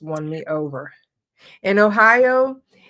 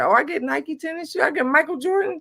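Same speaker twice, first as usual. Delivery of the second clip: put-on high, giddy kid over new shoes; excited teen; 10 semitones higher than usual. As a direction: gush high like a kid getting sneakers.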